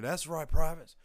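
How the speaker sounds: high, loud, put-on drill sergeant bragging; boastful sergeant. Drill sergeant boasting to privates during PT, loud and put-on. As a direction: crow at the privates like a proud sergeant.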